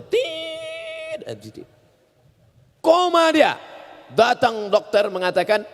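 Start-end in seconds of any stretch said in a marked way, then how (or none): 0.0-1.2 one long very high steady beep; long flatline beep. Shown as a demonstration. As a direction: hold a flatline tone. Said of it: "Kalau titik itu panjang dia". (bunyi tit panjang tinggi seperti monitor berhenti)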